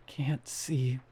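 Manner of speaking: breathless, choked whisper; gasping whisper. Acted. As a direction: whisper it through broken breath. Beaten victim gasping for air.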